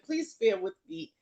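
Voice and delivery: ordinary talk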